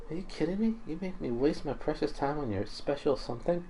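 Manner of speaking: a hint of a girl moved by mom's cooking; lightly voiced girl, scornful then wistful.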